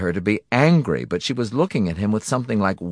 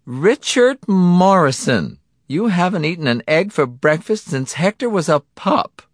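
wife's voice; 10 semitones higher, 4 dB louder than usual. A narrator's reading. Wife Cindy voiced in story.

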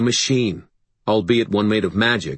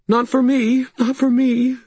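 high, louder, panicky repetition; distraught; 4 dB louder than usual. A narrator's reading. Sarissa answering talk of hope, distraught. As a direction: insist high, in rising despair.

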